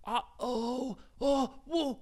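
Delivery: put-on high, startled kid voice; a sudden, high little gasp of alarm; worried Ryan. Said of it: "Ryan said".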